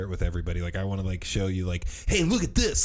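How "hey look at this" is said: high, eager call; excited. Retold or imitated. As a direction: show it off, excited. Showing friends something excitedly.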